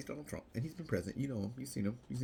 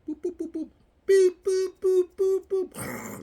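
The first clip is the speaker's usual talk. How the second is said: much higher, loud mock censor beeps; beeping buzzer; 17 dB louder, slower than usual. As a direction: beep him out like a lie alarm. Beeping out Biden's false claim.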